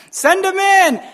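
high-pitched, loud, gleeful order; eager mock shout. Israelite men calling for the women, eager mock shout. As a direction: holler it with comic eagerness.

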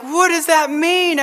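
asking eagerly, hardly able to wait; eager excitement. Retold or imitated. Preacher retells asking the church lady, excited and eager. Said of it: "I was so excited".

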